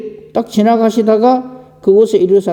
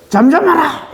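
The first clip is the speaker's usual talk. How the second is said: mock rebuke; 10 semitones higher than usual. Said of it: "그를 꾸짖어".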